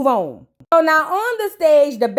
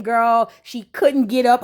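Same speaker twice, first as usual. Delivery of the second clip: usual speaking voice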